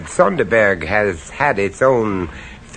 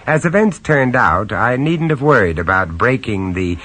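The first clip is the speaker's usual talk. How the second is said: low-key, wry first-person narration; lightly amused storyteller; story narration; 4 semitones lower than usual. A narrator's reading. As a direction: confide it to the listener.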